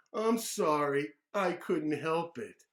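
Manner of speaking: put-on loud, slow childish apology; sorry but not really; drawn-out little-boy excuse; kid apology. David ruined class picture.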